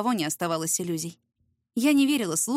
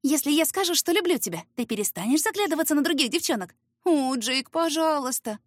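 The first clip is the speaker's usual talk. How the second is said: mock swooning; 6 semitones higher than usual.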